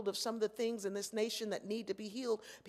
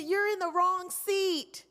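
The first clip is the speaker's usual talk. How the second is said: higher, louder, slow and pointed; stern correction; 10 semitones higher, 8 dB louder, slower than usual. Retold or imitated. Flight attendant scolds the blind passenger, stern and slow. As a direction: talk down to the passenger slowly.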